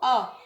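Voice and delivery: a clear open "A" for pupils to repeat; letter A said loud. Shown as a demonstration. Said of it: "la première lettre qui se prononce avec moi". (حرف A بصوت عالٍ وواضح)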